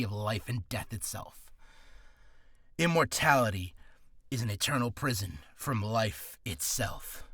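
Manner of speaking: low, soft brooding monologue; cursed immortal. Acted. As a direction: deliver it low and grim, sinking almost to a whisper. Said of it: "he's miserable, annoyed, and angered".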